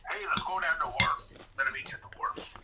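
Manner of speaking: put-on breathy half-whispered Nova Scotia accent, leaning on "wharf"; a quick, airy Maritime Canadian impression; mock Nova Scotia accent. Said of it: "Nova Scotia where they're always talking about the wharf".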